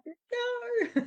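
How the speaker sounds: mock high-pitched squeal of dread; inner panic. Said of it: "But inside, I'm like".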